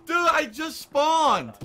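high, loud, drawn-out protest; real indignant wail; exasperated yell. Real, not acted. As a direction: stretch it out in loud disbelief. Protests instant death.

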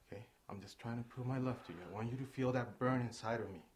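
usual speaking voice

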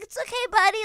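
high-pitched pet-soothing voice, imitated; strained, high mock reassurance; reassuring the dog. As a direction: placate a dog that just bit you. Retold soothing of attacking dog.

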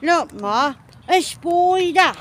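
mock British accent, last word high and stretched; exaggerated British attempt, slowing at the end; Oh My Gosh. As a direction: imitate a bad British accent, drawing out spider. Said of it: "tries to do the British accent".

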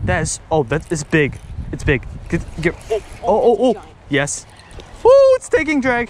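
excited, rising pitch; hyped while reeling in; fighting a fish. Real, not acted. Hooked a big fish.

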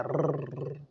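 "रर" as a long motor-like trill; drawn-out rolled R on "रर". Shown as a demonstration. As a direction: hold a buzzing "रर". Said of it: "ये आप बंद कर दीजिए".

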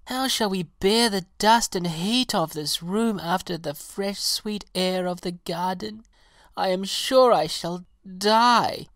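high, loud, melodramatic lament; complaining pansy. A narrator's reading. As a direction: wail about your hardship in a high voice. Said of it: "grumbled the pansy".

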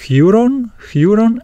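Huron with "hyu" at the start; alternative pronunciation. Shown as a demonstration. Teacher showing others' version of the Greek, Huron opening on "hyu". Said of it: "then they would pronounce".

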